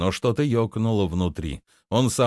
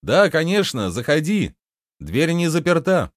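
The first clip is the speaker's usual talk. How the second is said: high call from behind a door; muffled barbarian voice; 7 semitones higher than usual. A narrator's reading. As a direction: call back to the visitor, pitched up. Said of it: "раздался глухой голос варвара". (отзываясь из-за двери)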